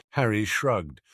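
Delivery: plain reading voice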